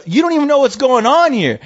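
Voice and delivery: high-pitched retold comeback; indignant retort. Repeats retort told to officer.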